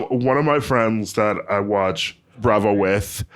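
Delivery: ordinary delivery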